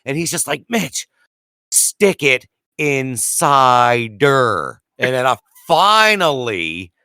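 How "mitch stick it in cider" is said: very drawn-out, word by word; slow emphatic hint. Retold or imitated. Bill repeating his hint to clueless Mitch, slow and emphatic. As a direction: spell it out slowly for someone not getting it. Coworker spells it out slowly.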